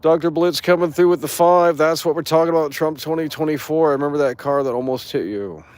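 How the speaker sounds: high, loud announcer hype; shouting out a viewer's donation; hype donation read. Acted. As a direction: call it out like a donation alert. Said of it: "reading a donation aloud".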